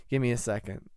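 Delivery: usual speaking voice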